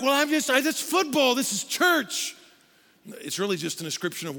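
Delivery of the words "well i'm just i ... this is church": high, loud, slowing at the end; flustered excuse. Retold or imitated. Football fan excusing himself at church, high. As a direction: stammer a defensive excuse. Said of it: "You're like".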